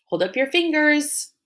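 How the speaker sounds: normal talking voice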